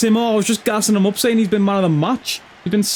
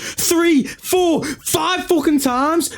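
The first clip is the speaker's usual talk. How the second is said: high, strained furious rant about missing subs; raging; 11 semitones higher, slower than usual. Real, not acted. Gamer raging at the game over missing subs, furious. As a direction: scream it at the game, livid. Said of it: "I'm actually raging".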